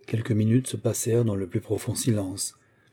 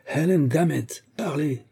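angry shout; 6 semitones higher than usual. Acted. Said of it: "cria le vieillard rouge de colère".

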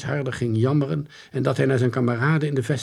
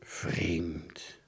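softer, lower mumble; mumbling; 6 semitones lower, 11 dB quieter than usual. Acted. Detective puzzling alone, low soft mumble. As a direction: mutter it to yourself. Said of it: "mompelde Van der Lorre".

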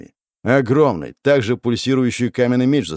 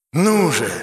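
higher, urgent cry; eager shout; 7 semitones higher than usual. A narrator's reading. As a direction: urge him on eagerly. Fanatic urging master. (восторженный возглас)

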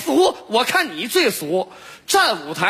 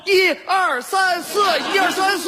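barked drill commands; 7 semitones higher than usual. Acted. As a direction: bark the count like a drill sergeant. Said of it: "你喊口号".

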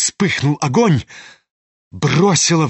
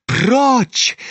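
shouted order; 9 semitones higher than usual. Acted. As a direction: push him away with a shout. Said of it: "закричала Настасья Филипповна". (Настасья кричит Лебедеву: прочь!)

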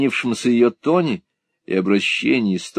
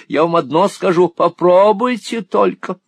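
playful dare; 9 semitones higher, 5 dB louder than usual. A narrator's reading. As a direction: tease him to just try it. Miss La Creevy teasing. (игривый вызов, высоко и громко)

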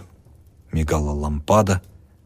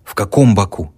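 usual speaking voice

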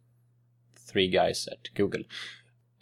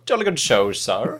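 booming posh English gent, higher and louder; hearty booming country squire; 3 semitones higher, 6 dB louder than usual. Pretend. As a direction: play a jolly upper-class Englishman. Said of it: "My posh gentleman".

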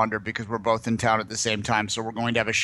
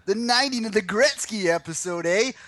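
hammed-up, higher-pitched rural Canadian twang; rural Canadian accent; 8 semitones higher than usual. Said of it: "rural Canadian accent".

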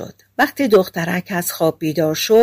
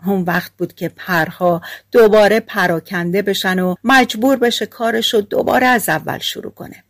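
plain reading voice

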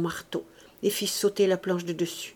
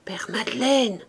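hushed, whispery call; half-voice. A narrator's reading. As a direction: call under your breath into the grave. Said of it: "dit à demi-voix".

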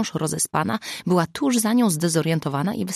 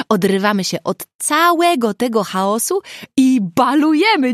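rallying; 7 semitones higher, 6 dB louder than usual. A narrator's reading. Zuza declaring a party.

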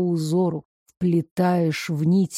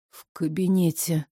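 weak voice while being shaken; faint, jolted by shaking; 4 semitones lower, 4 dB quieter than usual. Acted. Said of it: "Не надо меня тормошить".

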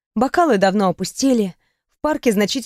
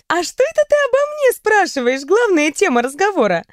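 put-on higher, teasing girlish voice; playful young woman; 9 semitones higher than usual. A narrator's reading. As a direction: tease her with a mischievous lilt. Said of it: "Ри улыбнулась, бросив на меня озорной взгляд".

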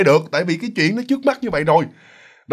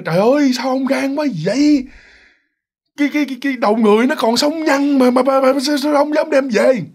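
high-pitched shocked wife, stammering; horrified scolding; 9 semitones higher than usual. Retold or imitated. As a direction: stammer in alarm at what he brought home. Vợ hoảng hốt trách chồng. (giọng vợ hoảng hốt, lắp bắp)

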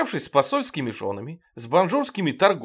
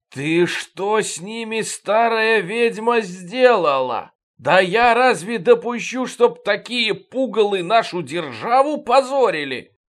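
slow, loud and higher outrage; angry slow shout; 6 semitones higher, 5 dB louder, slower than usual. A narrator's reading. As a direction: drag out each word in fury. King yells at old woman. (медленный гневный крик)

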